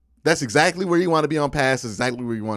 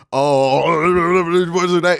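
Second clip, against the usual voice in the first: loud, higher mock wail; mock outburst; 5 semitones higher, 6 dB louder, much slower than usual. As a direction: blurt it out in mocking exaggeration. Mocking rant about lying to ourselves.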